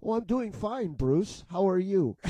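high, loud friendly reply; lightly voiced German friend; German friend imitation. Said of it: "And they would say to me".

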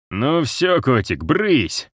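slow, high mocking taunt. A narrator's reading. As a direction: sneer at the cat. Traitor mocks the cat.

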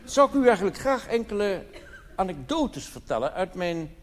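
normal talking voice

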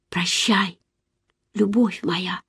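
soft murmur to oneself; quietly, under the breath; whispered farewell. A narrator's reading. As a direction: say a private, hushed goodbye to a lover. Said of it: "прошептала я про себя".

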